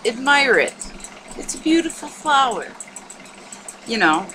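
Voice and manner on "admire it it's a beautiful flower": high, airy imitation of a book; gushing book voice. Quoting library books on the body, high gushing imitation.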